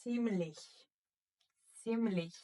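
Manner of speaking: Ziemlich with ts and soft ch, twice; German Ziemlich. Shown as a demonstration. Teacher reading the board word aloud, model repetition. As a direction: model Ziemlich as tseem-lich. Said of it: "How are we going to read this word".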